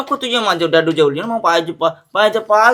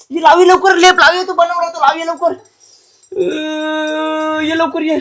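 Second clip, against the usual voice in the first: hammed-up high wailing for help, much higher and louder; mock pain; 11 semitones higher, 7 dB louder than usual. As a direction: pretend the foot hurts and yell for help. Father fakes foot pain, calls. (पाय दुखल्याचं नाटक करत मोठ्याने ओरडणे)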